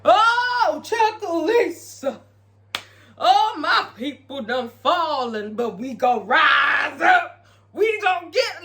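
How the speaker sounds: high, slow, drawn-out preacher voice rising to a shout; mock preacher. [name] preaching to the masses on camera, hammed-up slow sermon. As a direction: ham up a church sermon to the crowd. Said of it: "She's preaching, preaching to the masses".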